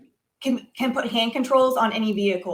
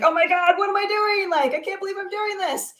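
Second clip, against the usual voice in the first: high-pitched mock panic at oneself; retold panic; 9 semitones higher than usual. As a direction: act out a moment of overwhelmed disbelief, pitch up high. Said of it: "some moments of fear".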